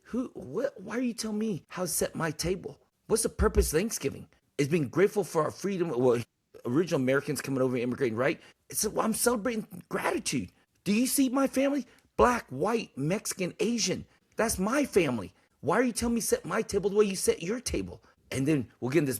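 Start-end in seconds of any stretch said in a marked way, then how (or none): 0.0-5.9 higher, rising indignation; retelling his indignant reply at Thanksgiving, higher; indignant outburst. Retold or imitated. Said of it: "And I said". 8.7-18.0 heated pitch. Retold or imitated. Heated retold Thanksgiving argument.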